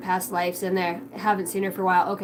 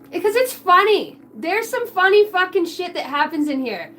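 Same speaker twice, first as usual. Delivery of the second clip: high, loud and gleeful; higher, louder gleeful burst; excited; 7 semitones higher, 5 dB louder than usual. Real, not acted. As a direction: burst out with delight. Laughing about her own stream.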